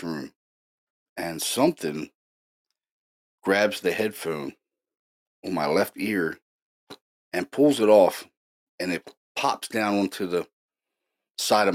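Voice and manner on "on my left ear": usual speaking voice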